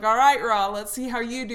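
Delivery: loud, pumped-up call-out; eager challenge. Retold or imitated. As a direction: throw down the challenge loudly. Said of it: "So I'm like".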